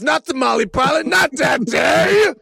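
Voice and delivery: high, slow, drawn-out shout; heroic shout. Acted. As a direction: cry it out like a doomed hero. Reprises heroic pilot rant.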